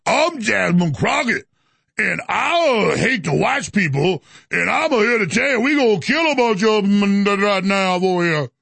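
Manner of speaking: put-on slow, drawn-out caricature of her changed accent; Jasmine Crockett. Host parodying a congresswoman's new accent, mocking drawl. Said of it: "Now she's like".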